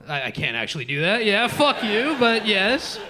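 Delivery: ordinary delivery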